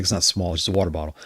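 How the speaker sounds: ordinary talk, nothing marked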